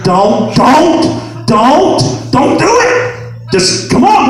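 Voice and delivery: rising, high-pitched repeated warning; acted-out worried wife holding someone back; urgent pleading. Wife pleads while pulling him away.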